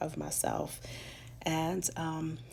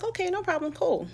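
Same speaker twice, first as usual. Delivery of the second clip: much higher, breezy "okay, no problem, cool"; airhead; 5 dB louder than usual. Retold or imitated. Brushing off the spirits' warning, airhead sing-song. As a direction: shrug it off like an airhead.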